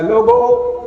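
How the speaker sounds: sudden high call to the crowd. Acted. Messenger calls Kufa's people from the street, high and ringing. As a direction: cry out to the whole street. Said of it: "Kufa کی گلیوں میں آواز لگا رہا ہے".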